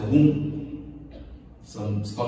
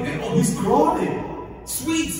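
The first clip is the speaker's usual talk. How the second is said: delighted parent; 11 semitones higher, slower than usual. Retold or imitated. Parent delighted baby crawls.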